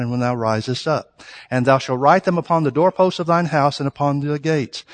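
soft, measured reading of the verses; quiet scripture reading. Acted. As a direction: read the verses low and reverent. Reads scripture aloud, subdued.

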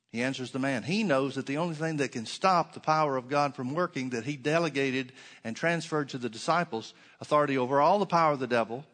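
ordinary talk

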